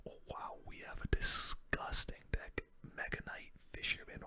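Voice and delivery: usual speaking voice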